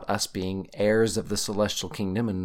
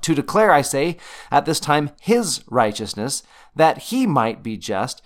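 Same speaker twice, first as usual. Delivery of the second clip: higher and louder, HIS and HE punched; emphatic scripture reading; 4 semitones higher, 5 dB louder than usual. Shown as a demonstration. Teacher reading Paul so listeners catch it, HIS stressed. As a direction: drive home "his" and "he" as you read. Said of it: "emphasize the word I wanna bring out".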